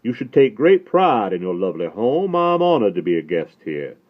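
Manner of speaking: courtly Southern compliment to the hostess; mellifluous Southern accent. A narrator's reading. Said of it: "From the mellifluous southern accent".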